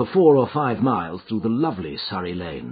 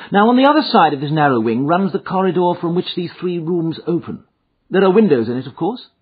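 higher-pitched detective voice; brisk, methodical sleuth; Holmes, inquiring; 8 semitones higher, 5 dB louder than usual. A narrator's reading. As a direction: reason through the layout aloud. Holmes questions the layout.

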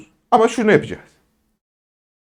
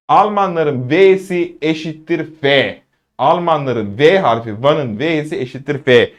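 slow, deliberate teaching delivery; measured, drawn-out emphasis; emphatic rule; slower than usual. Shown as a demonstration. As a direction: spell the rule out slowly. Teacher stressing the letter rule.

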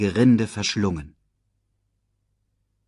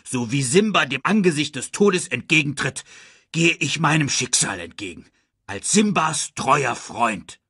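higher, declamatory pledge; solemn vow; 6 semitones higher than usual. A narrator's reading. Pumba vows to follow Simba, grandly. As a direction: proclaim it heroically, slowing at the end.

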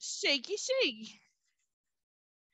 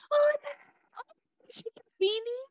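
very high, fast little-bee hello; tiny greeting; 13 semitones higher, much faster than usual. Acted. The bee greeting her friend in the plane, very high squeak.

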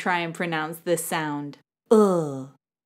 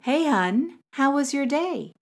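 higher-pitched, lightly acted homecoming hello; husband greeting wife; 6 semitones higher than usual. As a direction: greet your wife as you walk in, pitch lifted. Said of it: "you're the husband and you've just come home".

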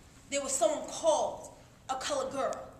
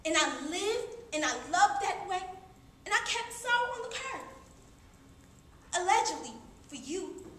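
ordinary talk

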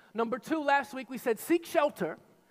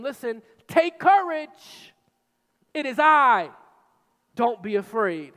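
commanding call; 2 semitones higher, 8 dB louder than usual. Acted. Jesus calling to terrified disciples across the waves, strong and commanding. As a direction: call over the storm with authority.

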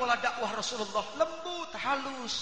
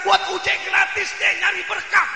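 louder and higher, shouting the quote; shouted retelling; 6 semitones higher, 10 dB louder than usual. Retold or imitated. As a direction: shout her offer back in disbelief. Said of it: "Ini perempuan bilang". (mengulang ucapan perempuan sambil berteriak)

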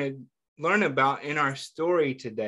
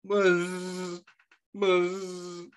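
imitated buzz of hair clippers; 4 semitones higher than usual. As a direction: make the shearing clippers buzz. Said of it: "they went".

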